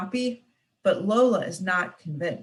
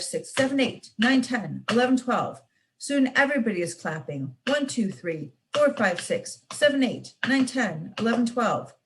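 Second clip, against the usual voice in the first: usual speaking voice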